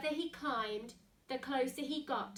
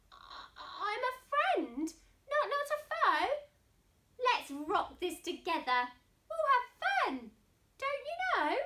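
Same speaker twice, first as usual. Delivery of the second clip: high, friendly mouse voice; cheerful mouse; 8 semitones higher, 4 dB louder than usual. Acted. Mouse befriending the lion, high and cheerful. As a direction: offer friendship brightly.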